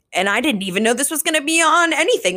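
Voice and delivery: mock-gushing disbelief; excited freak-out. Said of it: "she was just freaking out".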